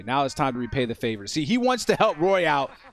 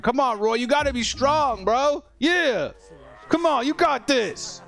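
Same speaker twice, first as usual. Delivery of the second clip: higher, encouraging pep talk; cheering on; 9 semitones higher than usual. Real, not acted. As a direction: pump up the scared fighter. Cheering on scared character.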